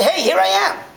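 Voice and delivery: loud, high call across a distance. Retold or imitated. Calling out to someone far off, loud and high. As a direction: yell it out to someone far away. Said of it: "you have to yell to say".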